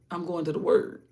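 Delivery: ordinary delivery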